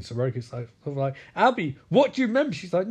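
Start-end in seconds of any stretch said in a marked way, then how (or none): 1.3-2.5 higher, quick pressing question; mock insistence. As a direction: press for an answer in acted impatience. Repeated question, growing insistent.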